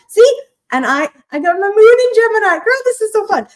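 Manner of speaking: high, loud thrilled chatter; excited. Real, not acted. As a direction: gush with delight. Shares same Gemini moon.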